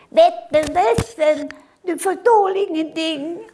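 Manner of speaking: put-on child refusing the soup; screaming child. Acted child voice. (skrikande barn som vägrar)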